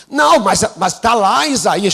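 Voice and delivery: a jump up in pitch and volume, protesting; mock protest. Pastor imitating a colleague's loud protest. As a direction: play the colleague objecting loudly.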